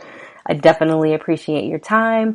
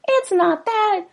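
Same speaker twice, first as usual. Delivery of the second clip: much higher, pleading wife voice, slow; voiced frightened wife; slower than usual. Host reading lyrics gives the wife a high, frightened plea. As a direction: pitch way up as the scared wife.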